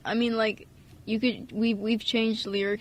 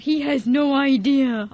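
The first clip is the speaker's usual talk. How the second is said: slow, louder knowing remark; slow, louder mock-smug line; quoting own line; 3 semitones higher, 6 dB louder, slower than usual. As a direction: lean on it like a smug aside. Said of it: "The reason why I said".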